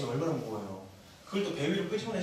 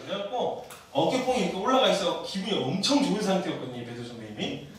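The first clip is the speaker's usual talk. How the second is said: ordinary talk, nothing marked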